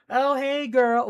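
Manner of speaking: high, loud friend-spotting voice; hammed-up chatty mom hello; gushing greeting. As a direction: sing out the greeting.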